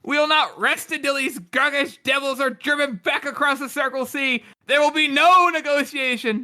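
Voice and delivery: high, strong oratory; rousing speech. Acted. King rouses the crowd, high strong oratory.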